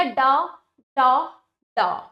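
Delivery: डा with a long rounded aw, as in doll; English o vowel. Shown as a demonstration. Teacher demonstrating the foreign vowel, डा said as a long daw. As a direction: stretch डा slowly on an English aw. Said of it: "अ, ध्वनि है ये".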